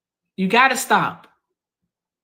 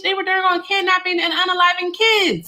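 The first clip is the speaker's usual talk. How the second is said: high-pitched, incredulous outburst; exasperated and disbelieving, high throughout; indignant rebuttal; 8 semitones higher than usual. Real, not acted. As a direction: argue back hard, voice pitched way up. Heatedly disputing his claim.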